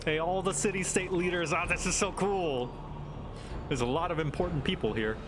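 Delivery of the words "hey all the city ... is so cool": normal talking voice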